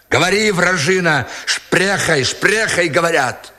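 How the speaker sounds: high-pitched insistent demand; angry interrogation. A narrator's reading. Sergeant interrogating the German woman, high angry demand. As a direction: press the captive hard for an answer.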